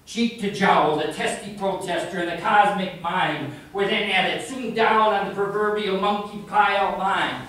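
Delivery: emphatic narrating verse with stretched key words; poetry recital. Acted. Poet reciting the climax to an audience, declamatory. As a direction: declaim it, surging then easing to a close.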